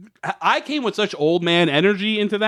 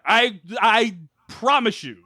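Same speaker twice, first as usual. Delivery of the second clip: usual speaking voice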